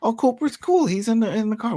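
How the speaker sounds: high-pitched, offhand mock reassurance; breezy dismissal. Host mimics a mother shrugging off where the kids are, breezy. Acting out mom's breezy reply.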